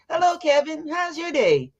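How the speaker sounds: bright, high greeting to a stranger; cheery greeting. Retold or imitated. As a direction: greet him sunny and high. Repeats cheery greeting to cashier.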